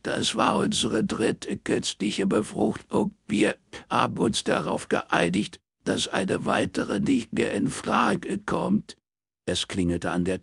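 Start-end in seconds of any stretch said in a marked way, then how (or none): 0.0-9.0 high, slow weeping; despairing sobs, words drawn out; in tears again. A narrator's reading. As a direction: explain through renewed crying. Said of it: "brach erneut in Tränen aus".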